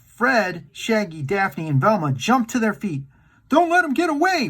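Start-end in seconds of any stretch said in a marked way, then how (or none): 3.5-4.5 urgent yell. Acted. Fred shouts to the gang to catch the ghost, higher yell. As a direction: call it out so they chase him. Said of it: "Fred yelled".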